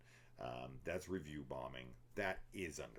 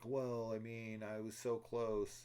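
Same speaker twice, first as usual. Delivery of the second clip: mock whiny guy; 7 semitones higher, 4 dB louder, slower than usual. Mocking guy who won't stop.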